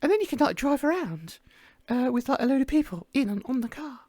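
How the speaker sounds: ordinary talk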